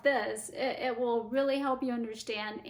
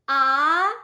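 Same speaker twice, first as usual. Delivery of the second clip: "ah" gliding upward, loud; rising second tone; 3 semitones higher, 12 dB louder than usual. Shown as a demonstration. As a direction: Mandarin second tone on "ah", climbing. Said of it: "the tones again".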